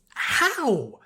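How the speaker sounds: high, loud startled "How?"; surprised cry. Acted. Rarity caught hiding in the shadows, startled cry. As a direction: blurt it as you're caught out. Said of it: "Rarity cried".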